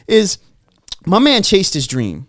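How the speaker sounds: defending his guy with fire; emphatic anger; heated insistence. Real, not acted. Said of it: "'cause it pissed me off".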